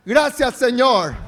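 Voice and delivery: slow, loud call of thanks. Real, not acted. Pastor leading church to shout thanks loudly. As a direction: shout your gratitude up. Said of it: "Pero digan bien fuerte".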